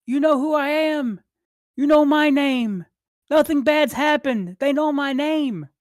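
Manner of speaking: high, desperate acted scream; Willem screaming. Brother screams at the runaway boy, high and desperate. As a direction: scream it high to make him listen. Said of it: "And he screams".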